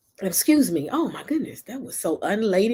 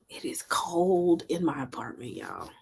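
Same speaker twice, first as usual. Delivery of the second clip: whispered; 4 semitones lower, 6 dB quieter than usual. Real, not acted. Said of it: "I'm freezing".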